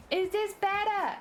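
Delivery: high, slow, coy cute voice; high-pitched cutesy voice. Pretend. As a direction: ask it in a high anime coo. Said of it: "I can speak high-pitched as well".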